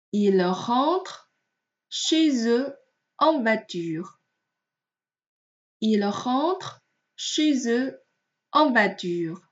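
slow phrase-by-phrase reading, "chez eux" linked by z; liaison model reading. Shown as a demonstration. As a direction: join "chez eux", pausing between phrases. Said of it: "也是要进行连诵的". (慢速分句示范，chez eux连诵)

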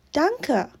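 usual speaking voice

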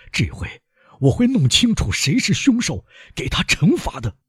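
high-pitched vengeful outburst; agitated cry for punishment; impassioned vow. A narrator's reading. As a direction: swear revenge, voice climbing high. Father vows to punish the murderer.

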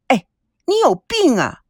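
high, sharp outburst at him; flaring up. A narrator's reading. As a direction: snap at him, suddenly fed up. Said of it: "王曼率先发飙".

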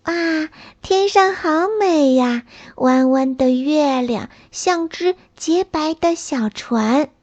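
put-on high, wonder-struck child voice; amazed little snail. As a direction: gasp in delight at the sky.

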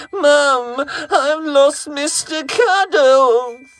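put-on high, loud, crying boy's voice; panicked child shout.

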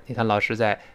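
ordinary delivery

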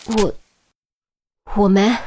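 halting, unconvincing denial; hesitant, powerless protest; feeble denial. A narrator's reading. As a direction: stammer out a weak no. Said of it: "语气之无力，连他自己都觉得没有半点说服力".

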